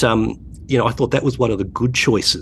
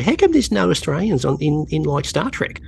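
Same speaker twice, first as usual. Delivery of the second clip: higher-pitched imitation of a teenage son asking; 6 semitones higher than usual. Son asking his dad during Star Trek, pitched-up teen imitation. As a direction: play the curious teenager, pitched up. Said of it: "you're a typical teenager".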